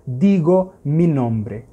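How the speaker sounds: slow, each word spaced; teacher showing the Spanish sentence slowly; slow Spanish example. Shown as a demonstration. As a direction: model the Spanish phrase. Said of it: "em espanhol será".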